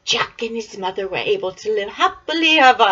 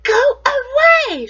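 high, slow shooing cry; giant's wife; 9 dB louder, slower than usual. Acted. Wife turning Jack away at the door, high and drawn out. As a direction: shoo the boy off, drawn out.

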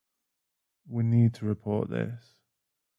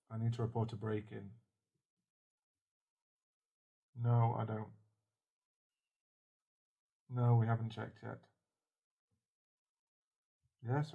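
quiet phone call; 9 dB quieter than usual. Acted.

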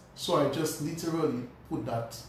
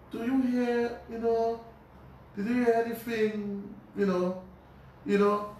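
hesitant aunt; 6 semitones higher than usual. Retold or imitated. Aunt asks about father.

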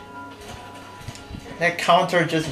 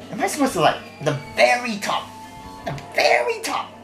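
incredulous; 10 semitones higher, faster than usual. Real, not acted. Frustrated disbelief at level.

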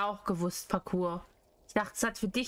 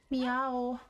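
cat meow. Pretend. Playing a cat.

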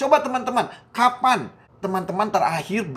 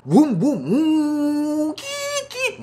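revving engine, then squealing high brakes; loud vroom rising into thin, very high screech; toy car noises; 10 semitones higher, 4 dB louder, much slower than usual. Pretend. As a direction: play cars like a boy with his toys. Imitates toy cars while playing.

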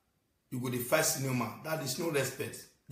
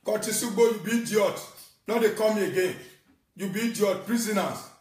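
mocking taunt; 7 semitones higher, 6 dB louder than usual. Taunting chant at another caller.

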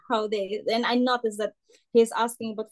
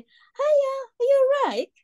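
very high, louder chirpy greeting; imitating shop staff; 4 dB louder than usual. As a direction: sing it out like cheerful staff at the till. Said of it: "the staff would say".

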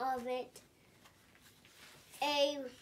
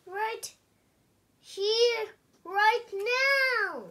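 higher and louder insistent demand; demanding pigeon; 8 semitones higher, 10 dB louder, slower than usual. Acted. Pigeon insisting to the reader, higher and louder. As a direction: demand it right now.

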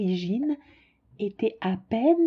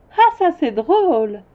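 put-on amused child; 6 semitones higher, 6 dB louder than usual. Prince amused at pilot.

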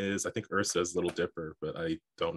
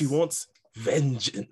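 higher, louder character voice, drawn out slowly; wobbly first try at a dramatic character line; character voice attempt; 5 semitones higher, 4 dB louder, slower than usual. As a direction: have a go at the character voice, loud and slow. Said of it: "You gotta say it in the voice".

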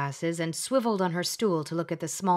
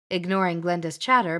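plain reading voice

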